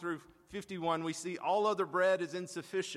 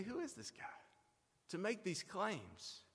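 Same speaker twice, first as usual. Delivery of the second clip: much softer, slow scoffing question; scoffing crowd; 3 semitones lower, 11 dB quieter than usual. Retold or imitated. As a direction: mutter it in disbelief, slowly. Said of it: "And so they say".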